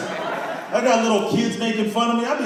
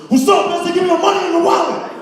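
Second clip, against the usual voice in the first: higher, louder mock robber demand; mugger voice; 11 semitones higher, 5 dB louder than usual. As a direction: play the thug with a knife, barking it out. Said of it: "pulls out a switchblade and goes".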